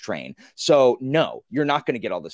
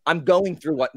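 usual speaking voice

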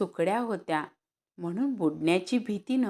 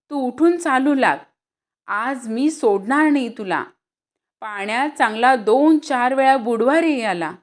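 loud, higher furious scolding; angry scolding; 6 semitones higher, 7 dB louder than usual. Acted. Mother scolding her son while beating him, angry loud scolding. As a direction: tear into him as you hit. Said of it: "आईने जोराने मारणे चालवले".

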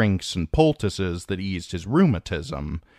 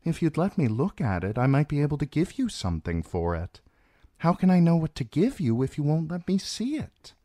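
higher-pitched, gently insistent woman; coaxing; 9 semitones higher, faster than usual. A narrator's reading. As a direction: coax a stubborn patient, voice lifted. Healer coaxes a stubborn patient.

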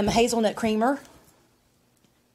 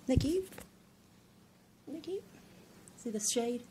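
cooing; 5 semitones higher, 13 dB quieter than usual. Real, not acted. Showing off lamp, cooing.

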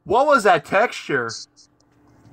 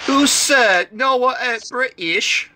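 mock British accent; 6 semitones higher than usual. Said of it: "You're not British".

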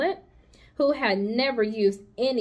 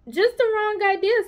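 Charlotte's voice; 11 semitones higher, 4 dB louder than usual. Acted. Charlotte rejects the word.

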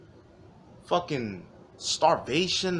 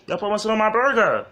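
high, loud, fast whiny customer complaint; angry customer voice; 8 semitones higher, 6 dB louder, faster than usual. Retold or imitated. Customer yelling at the cashier about mustard, high and whiny. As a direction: rant like an entitled diner over a burger.

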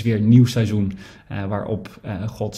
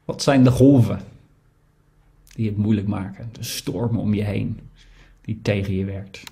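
guided reflection. Acted. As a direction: invite the congregation to listen inwardly. Leads a quiet reflection. (stille overdenking, zacht en traag)

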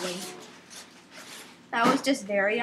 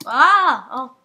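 sudden loud "ah"; startled cry; 12 dB louder than usual. Real, not acted. Player falls on obstacle course, loud startled cry. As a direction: yelp out loud mid-jump.

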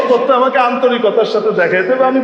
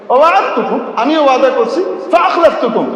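gloating Satan; 5 semitones higher than usual. Acted. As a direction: rub in the broken promise. Satan boasts broken promise. (শয়তানের উঁচু গলায় খোঁচা দেওয়া গর্ব)

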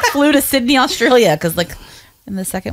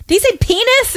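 high child's voice asking "He say penis?", mock yell; imitating her yelling kid; 13 semitones higher than usual. Mother retelling her son asking about the word in the theater, high mock child yell. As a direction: play a four-year-old blurting it out in the cinema. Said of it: "And he's just screaming".

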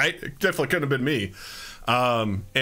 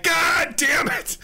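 high slow shouted "God damn it"; pained outburst; 12 semitones higher, much slower than usual. Retold or imitated. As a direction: yelp it as the dog bites your thumb. Dog attacks his thumb.

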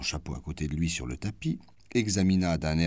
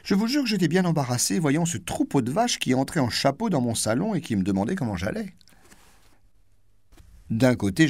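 higher, fast mocking duchess; duchess tirade; 6 semitones higher, 4 dB louder than usual. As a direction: gleefully ridicule the herd.

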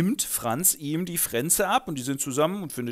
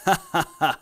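lautes, hämisches Ha-ha-ha; hämisches Lachen; 6 dB louder, faster than usual. Acted. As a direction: lach ihn überlegen aus. Reinhold lacht überlegen. (Reinhold lacht Franz hämisch aus)

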